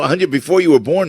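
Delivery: ordinary talk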